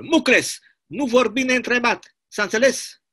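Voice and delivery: furious commandant telling him to shut up; barked order. Acted. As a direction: cut him off like a guard. Commandant silences a prisoner.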